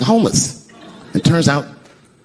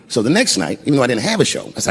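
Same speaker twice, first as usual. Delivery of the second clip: ordinary talk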